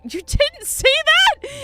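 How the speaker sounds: high, loud, slow disbelief; surprised. Retold or imitated. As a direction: gasp it in shock. Shocked that nobody noticed.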